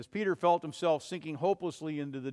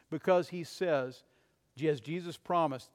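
normal talking voice

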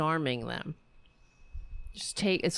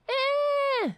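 drawn-out high mock screech; mocking yell; 4 dB louder than usual. Podcaster mocks the yelling politician, high wordless screech. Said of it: "Why are you always yelling at people".

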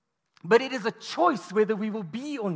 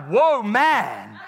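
mock awe; 7 dB louder than usual. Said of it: "he was all like".

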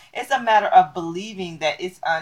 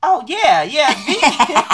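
higher, slow, laughing through it; laughing admission; 7 semitones higher, slower than usual. Retold or imitated. Caught out by a friend, laughing admission. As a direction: give it up with a giggle. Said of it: "admitting the sausage is vegan".

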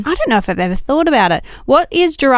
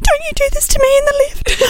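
mock quote. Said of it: "and George is like".

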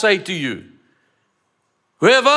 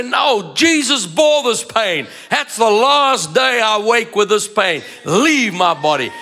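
bold declaration; 3 semitones higher than usual. Acted. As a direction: order the pain out.

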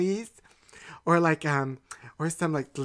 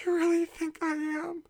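whimpering hurt; 10 semitones higher, 6 dB quieter than usual. Acted. Hurt after the slap.

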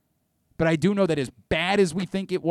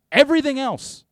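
1 exasperated; 12 semitones higher, 8 dB louder than usual. Real, not acted. Exasperated rant.

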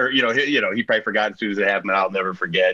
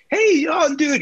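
excited greeting; slower than usual. Retold or imitated. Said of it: "And Duff was like".

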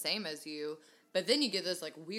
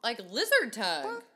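mocking; 6 semitones higher, 6 dB louder than usual. Mocking a sloppy kisser.

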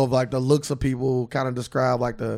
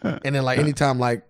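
ordinary talk, nothing marked

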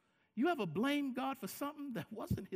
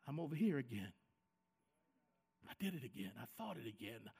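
sheepish confession; 10 semitones lower, 6 dB quieter, faster than usual. Retold or imitated. Chris confessing his failing to God.

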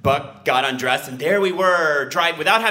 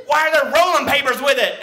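high, loud mock yell; hammed-up patrolman interrogation; accusing officer's shout; 12 semitones higher, 5 dB louder than usual. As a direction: fire the question like an outraged cop. Patrolman's angry question, acted.

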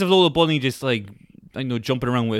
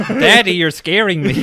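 put-on higher, louder whiny child; scared kid; 4 semitones higher, 5 dB louder than usual. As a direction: play a frightened little kid. Kid voice in mock dad scene.

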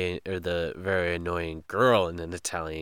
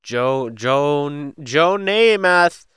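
climbing higher and louder, stretched out; drawn-out name; 6 semitones higher, 7 dB louder, much slower than usual. Pretend. Co-host playing with the athlete's name, drawn out. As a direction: build the name into a high, slow call. Mock-calling out the quoted player's name.

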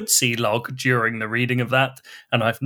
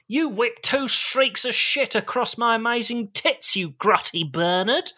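bellowed; 9 semitones higher than usual. Acted. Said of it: "the mother bellowed".

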